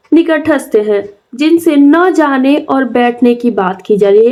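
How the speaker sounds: ordinary delivery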